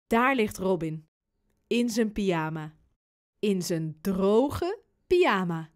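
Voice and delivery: ordinary delivery, nothing marked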